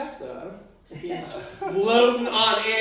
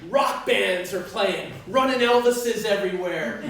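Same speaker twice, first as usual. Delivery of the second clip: ordinary delivery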